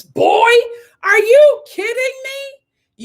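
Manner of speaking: high, slow incredulous mock; mocking Lee. Mocking Lee talking down to contestants. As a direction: ham up the contestant's disbelief. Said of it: "him calling contestants".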